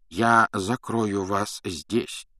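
put-on slow, measured old wizard; slow and calm. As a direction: lay each word down slowly. Dumbledore speaking. (медленно, размеренно)

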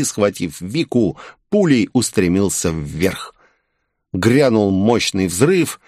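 ordinary delivery, nothing marked

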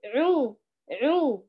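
عو pressed toward the nose, nasal; nasal mistake. Shown as a demonstration. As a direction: show the nasal error on عو. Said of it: "لا تضغطي باتجاه الخيشوم".

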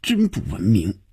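restrained anger, teeth clenched; through gritted teeth. A narrator's reading. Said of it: "她咬牙切齿地说".

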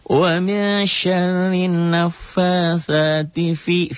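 high, slow chanted recitation; Quran recitation demo. Shown as a demonstration. Teacher chanting the verse for students, slow and high. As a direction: draw out the Arabic like a reciting teacher. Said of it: "fi-nya matobi nggak dibaca panjang".

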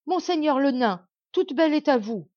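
loud, high cry at full force; queen crying to the dwarf as lions charge; desperate shout. A narrator's reading. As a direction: scream it out in panic. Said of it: "cria de toute sa force".

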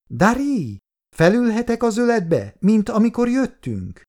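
put-on high-pitched little girl asking. A narrator's reading. Little girl asks the man for a ride, high child's voice. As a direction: ask it high, like a small child. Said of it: "kérdezte a kislány".

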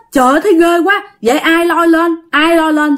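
imitated alarm, pitched well up; retold fright. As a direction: act out a spooked friend demanding answers. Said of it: "Xong nó sợ".

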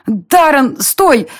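high, urgent call to stop; panicked cry. A narrator's reading. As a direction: call out in alarm to halt someone. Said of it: "Я запаниковала". (высокий панический оклик)

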